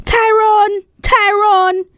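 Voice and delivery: high, carrying call across the yard; calling shout. Acted. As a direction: call your friend from the gate at full voice. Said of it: "Bello shouted".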